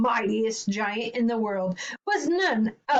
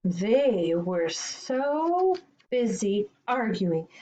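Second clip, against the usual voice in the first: ordinary delivery, nothing marked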